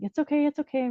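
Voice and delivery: mother to her limp newborn, high soothing coo; soothing reassurance. Retold or imitated. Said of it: "I'm like".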